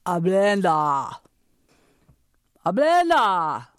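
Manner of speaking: put-on slow, Chinese-accented Ablenda; drawn out Ablenda in a Chinese accent; grandpa's Chinese accent. Grandpa looking at the child, slow Chinese accent. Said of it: "look me in the eye and say".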